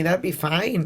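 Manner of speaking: slight Irish lilt on fine; an accidental hint of Irish; Irish accent. Real, not acted. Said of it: "You sound a little Irish there".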